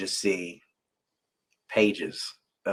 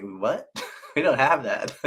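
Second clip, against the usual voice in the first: laughing mock clerk; 5 semitones higher than usual. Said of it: "I'll look at you all like".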